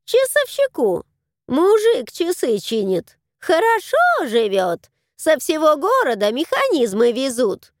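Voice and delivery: high, squawky crow. Acted. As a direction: voice the crow, nosy. Crow character voice.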